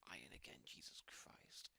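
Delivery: whispered grumble to himself; whispering annoyed blacksmith; muttered curse. Acted. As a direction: hiss the curse under your breath. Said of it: "He's like".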